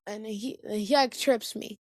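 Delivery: ordinary talk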